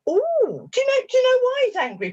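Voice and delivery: very high, playful questioning; talking to a puppet. Retold or imitated. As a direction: quiz a tiny imaginary creature with exaggerated wonder. Questions imagined part in hand.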